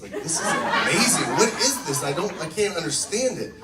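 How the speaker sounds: retold excited bewilderment; amazed disbelief. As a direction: exclaim it as if utterly baffled. Retelling amazed call to friends.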